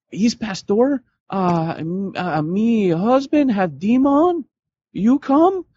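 high, slow, broken English with a put-on accent; high put-on accent, half joking; mock caller accent. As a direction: ham up a frantic foreign caller. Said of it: "crank call people like this".

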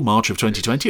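ordinary talk, nothing marked

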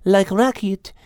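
high, light French-tinged cat voice; Liz the cat. A narrator's reading. Liz wishing the bored animals had something to do, lightly French.

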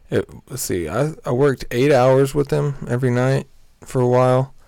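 usual speaking voice

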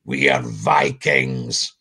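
low, slow mock-menacing Viking voice; teen pretending to be a Viking warrior, low and slow; fake deep voice. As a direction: boys putting on a deep growl to sound scary. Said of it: "they'd put a deep voice on".

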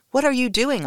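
high alarmed question; horror-stricken. A narrator's reading. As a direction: gasp it out in a sudden fright. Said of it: "I asked, horror-stricken".

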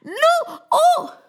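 very high, hammed-up wail; pleading moon. A narrator's reading. Moon begging Ganpati to stop, panicked pleading. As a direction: beg in a squealing, swooping voice.

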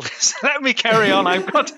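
laughing it out, loud and high; laughing reply. Retold or imitated. Climber laughing back at his friend, loud laughing protest. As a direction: blurt it through a laugh.

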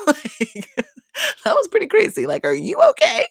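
high laughing are you okay; high amused disbelief. Real, not acted. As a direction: squeal it out in shock.

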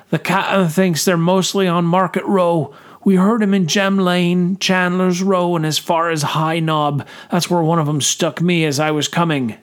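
through clenched teeth. A narrator's reading. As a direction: force words out through pain. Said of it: "through clenched teeth".

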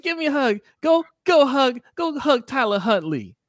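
high, slow gushing coach voice, laughing; mock gushing coach. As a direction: gush over your player, laughing. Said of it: "He's like".

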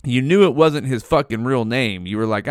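usual speaking voice

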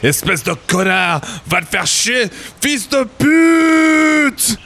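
rising shouted French curses; French insults. Acted. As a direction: hurl French insults, building to a yell. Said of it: "throwing a slurry of French insults".

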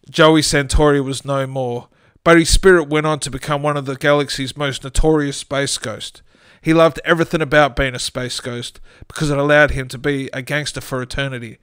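usual speaking voice

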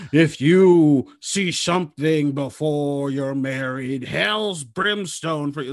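slow, drawn-out mock fire and brimstone; hammed-up preacher threatening damnation; hellfire preacher parody. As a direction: stretch every word like a doom-laden sermon. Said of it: "it doesn't have to be all this".

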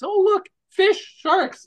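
high, slow, wide-eyed fish, sharks; naive gawker. Pretend. Mocking someone who takes Shark Tank literally, in a high, slow gawking voice. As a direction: play a clueless viewer spotting sharks.

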